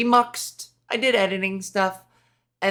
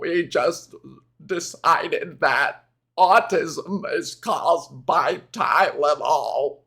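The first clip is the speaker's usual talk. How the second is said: mocking RFK; 4 semitones lower, slower than usual. Mocking RFK while describing him.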